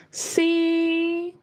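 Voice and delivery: C held high and level. Shown as a demonstration. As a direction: sing C on a steady high note. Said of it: "very high pitch".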